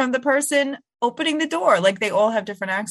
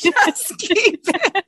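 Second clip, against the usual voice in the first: high, very slow, laughing put-on voice; giggling mock hoarder echo; 10 semitones higher, 4 dB louder, much slower than usual. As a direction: stretch it out like a silly hoarder.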